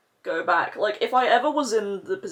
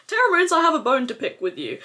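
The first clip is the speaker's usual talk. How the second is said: quick, loud, high-pitched telling-off; playfully furious; 6 semitones higher, 6 dB louder, faster than usual. Real, not acted. Vlogger confronting a makeup brand, playfully furious. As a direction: call out the brand with brisk indignation. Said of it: "I'm furious".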